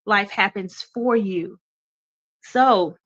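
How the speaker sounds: blocked nose, congested voice; talking through a stuffy nose. Real, not acted. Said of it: "I'm a little stuffy too".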